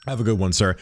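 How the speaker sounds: usual speaking voice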